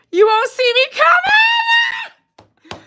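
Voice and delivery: very high, drawn-out playful shriek; squealed shout. Acted. Streamer repeating her line to viewers, high drawn-out squeal. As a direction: stretch it out into a high squealing yell.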